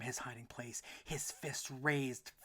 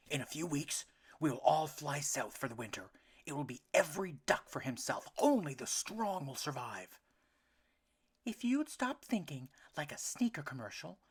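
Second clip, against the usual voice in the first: louder heated argument; arguing parents; 4 semitones higher, 7 dB louder than usual. A narrator's reading. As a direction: fight over Elmer. Parents argue.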